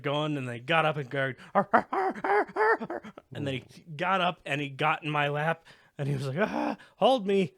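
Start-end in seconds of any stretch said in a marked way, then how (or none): 1.5-3.0 high yelping whine, rising; puppy whine imitation. Owner imitates his puppy whining on the drive, high yelps. 6.4-7.5 needy puppy. Retold or imitated. Puppy voiced climbing in lap.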